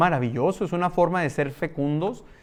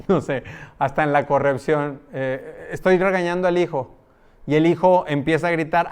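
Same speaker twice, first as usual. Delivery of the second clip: normal talking voice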